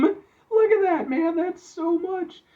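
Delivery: high, drawn-out marvelling; amazed. Real, not acted. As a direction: stretch it out in high-pitched awe. Marvelling at the huge pizza.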